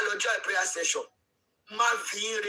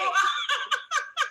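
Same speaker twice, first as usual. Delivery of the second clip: short high-pitched burst of laughter; high, amused cackle; laughing out loud; 4 dB louder than usual. Real, not acted. Amused by the story.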